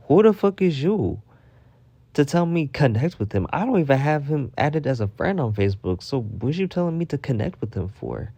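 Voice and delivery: outraged rant at a Facebook notification; indignant rant. Retold or imitated. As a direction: tell off the app like it's a person.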